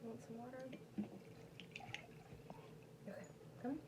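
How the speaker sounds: coaxing the dog. Real, not acted. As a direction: coax the pup toward the water bowl.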